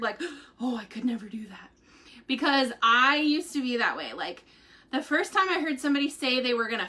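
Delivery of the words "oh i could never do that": hushed, fearful near-whisper; gasp, then whisper it as if daunted; acted scared. Voicing a viewer daunted by fasting, acted scared. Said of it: "like, scared".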